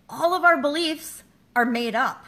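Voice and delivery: ordinary talk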